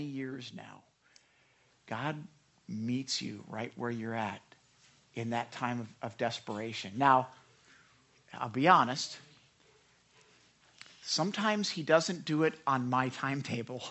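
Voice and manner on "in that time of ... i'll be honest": usual speaking voice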